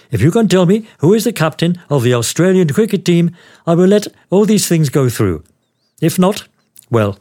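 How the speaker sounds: high-pitched voiced official; customs officer. Customs officer sets his test.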